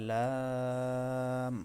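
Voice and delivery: লাম held long and drawn out very slowly; Arabic letter demo. Shown as a demonstration. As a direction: stretch the sound of লাম. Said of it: "আরেকবার বলি". (লাম দীর্ঘ করে টেনে উচ্চারণ)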